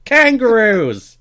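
hammed-up Aussie twang, loud and high; mock Australian accent. Podcast host opening in a mock Australian accent. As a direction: play up a cartoon Australian accent. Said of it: "you couldn't keep up the wonderful Irish accent".

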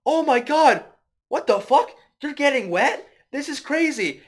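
high, loud hammed-up astonishment; amazed mock shout. Friend retelling movie, voicing the dad in loud amazed shock. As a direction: gasp it out in overdone shock.

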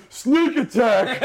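high, slow, laughing dopey yell; dumb half-orc shout. Retold or imitated. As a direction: bellow it like a clueless barbarian. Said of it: "dumb half-orc ... he goes".

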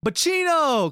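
put-on high, loud drunken shout of a name; drunk calling out. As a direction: holler the name across the dark room. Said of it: "I hear him go".

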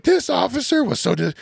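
high-pitched mock tattling; put-on high, aggrieved woman's voice; indignant complainer. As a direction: imitate a woman complaining to a supervisor. Imitating the woman complaining to supervisor.

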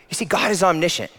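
ordinary talk

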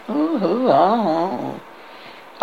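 high hooting "ooh, aah"; mock group hoot from the guards; guards' jeering noises. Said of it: "French guards".

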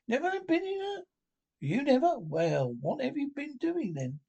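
high-pitched disbelief, voice far up; incredulous Rat. Acted. Rat questions Mole in high, amazed disbelief. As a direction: sound amazed anyone never boated. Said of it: "cried the rat, open-mouthed".

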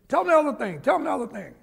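keen, insistent nudge, repeated; eager urging. Retold or imitated. Detective urging his partner to tell the runner, eager and insistent.

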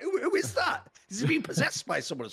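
high-pitched mock alarm; freaked out. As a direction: play baffled and spooked, voice shooting up. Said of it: "it's so freaky when they shift".

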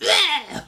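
high loud imp shriek; imp creature noise. Retold or imitated. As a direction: shriek like a little demon imp. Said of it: "like, kind of sound".

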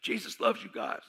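high, squeezed imitation of holding in smoke; just took a hit. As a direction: talk on a held breath after a puff. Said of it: "I took a hit. I said".

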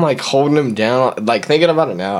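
normal talking voice

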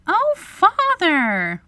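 put-on high, drawn-out girlish cry; reproachful exclamation. A narrator's reading. Wendy catches her father cheating, dismayed exclamation. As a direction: stretch it out in dismay. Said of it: "Wendy exclaimed".

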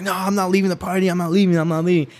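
high-pitched mock refusal, insistent; imitated drunken protest. Drunk friend resisting six people at a party, mock stubborn protest.

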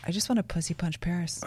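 low, soft aside in passing; retold murmur. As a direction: say it under your breath, imitating a friend. Friend walks by, remarks under breath.

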